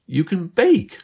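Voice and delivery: high, loud, amazed exclamation; exclaiming. A narrator's reading. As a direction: burst out in disbelief. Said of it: "Liz exclaimed".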